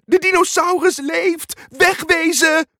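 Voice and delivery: high, loud panicked cry; panicked shout. Acted. Muk thinking the dinosaur is alive, panicked. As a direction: shout in alarm to flee. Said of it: "riep Muk".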